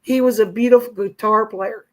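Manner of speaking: talking through tears, fighting to hold it together; crying. Real, not acted. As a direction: voice lifted and strained with tears. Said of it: "Sorry, I'm crying".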